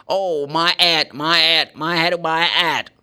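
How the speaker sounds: drawn-out mock Cockney wail, "my 'ead"; Cockney dropped H. Teacher hamming up a Cockney complaining, h dropped from "head". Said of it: "They don't pronounce the H".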